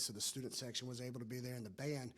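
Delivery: usual speaking voice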